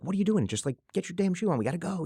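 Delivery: put-on high, exasperated snap at a toddler; mock impatient yell. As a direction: hurry the kid, fed up. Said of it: "you know, yelling at her".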